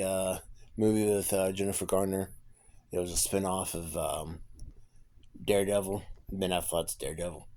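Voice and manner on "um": ordinary talk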